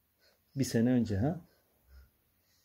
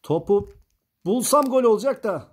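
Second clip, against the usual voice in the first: higher, louder excited calling; 9 semitones higher, 7 dB louder than usual. Real, not acted. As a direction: get worked up over the shot. Excited during football game.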